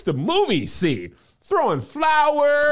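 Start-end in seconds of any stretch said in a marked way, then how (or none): 1.4-2.6 high, slow excited call; excited shout. Real, not acted. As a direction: burst it out excitedly.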